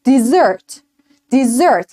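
dessert with stress on the second syllable, falling. Shown as a demonstration. Teacher models dessert for the class, stress last. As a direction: push the stress onto the end of dessert. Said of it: "استرس میره روش".

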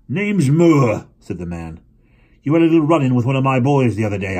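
loud, fast menacing man; an angry father confronting the boy; gruff stranger. Acted. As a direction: press him with veiled threat. Mr Moore's voice.

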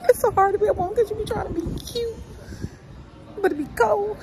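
high, fast whiny lament; mock whine. Real, not acted. Lamenting to viewers about dressing cute in cold, whining. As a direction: whine it like it's tragic.

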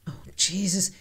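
hushed, barely voiced gasp of fear; whispered oh Jesus under the breath; scared whisper. Real, not acted. Creepy footage, frightened aside.

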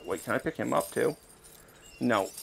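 usual speaking voice

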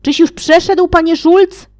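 loud, high call; calling across water. Acted. The companions calling across the river to Mr Schulz, loud and high. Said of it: "Towarzysze jego zawołali".

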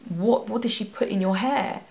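normal talking voice

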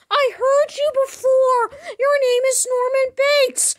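very high, loud squeaky cartoon voice; squeaky partner voice. New partner greeting the worker in a very high squeaky voice. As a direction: bounce in as an overeager sidekick.

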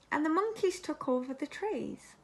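ordinary delivery, nothing marked

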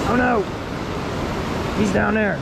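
high worried cry; sudden worry for the dog; alarmed. Real, not acted. Dog fell into the water.

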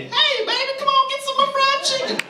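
very high, loud sassy ad lady; Popeyes lady voice. Acted. Popeyes ad lady calling to customers, very high sassy voice. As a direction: call out sweet and sassy like a fast food spokeswoman.

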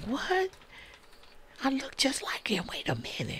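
high, stunned, then hushed; shocked disbelief. Retold or imitated. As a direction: gasp it, then whisper it. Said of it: "I'm like".

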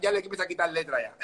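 ordinary talk, nothing marked

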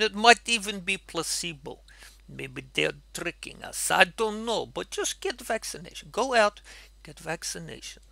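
put-on comic Russian accent in English; fake Russian accent. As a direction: play Yuri with a thick Russian accent. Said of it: "I still talk in Russian accent".

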